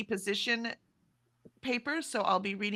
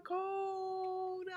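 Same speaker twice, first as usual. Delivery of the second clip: higher and softer, like an echo fading; hilltop echo; 12 semitones higher, 7 dB quieter than usual. Pretend. Repeating the viewer's name as a distant echo, softly. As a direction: let the name fade like an echo off the hills. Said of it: "like I'm high on a hilltop".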